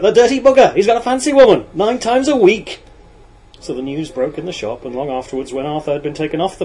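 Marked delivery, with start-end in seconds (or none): 0.0-2.8 loud, high, gleefully scandalised yell; shouting out. A narrator's reading. Lad broadcasting his boss's secret, shouting out. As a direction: holler the gossip at full voice. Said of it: "he shouted out".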